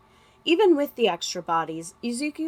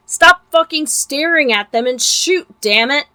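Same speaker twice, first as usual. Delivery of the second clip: louder, higher furious yell; 5 semitones higher, 9 dB louder than usual. Acted. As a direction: bark the order at them, loud. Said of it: "the first voice finally yelled".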